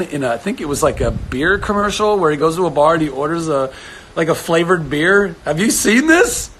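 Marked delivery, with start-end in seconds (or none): 5.4-6.5 excited. Real, not acted. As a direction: gush about it. Excited about a commercial.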